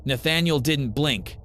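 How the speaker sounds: usual speaking voice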